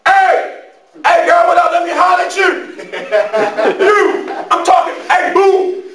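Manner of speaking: high, loud street holler; catcalling dude. Acted. Dude hollering at a passing girl, loud catcalling. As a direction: holler after a girl to get her attention. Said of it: "dealing with a dude talking about".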